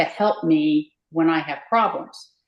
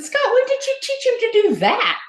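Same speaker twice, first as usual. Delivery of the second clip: much higher, astonished question to Scott; amazed question; 3 dB louder than usual. Retold or imitated. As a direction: ask Scott in real amazement, pitch flying up. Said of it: "I said".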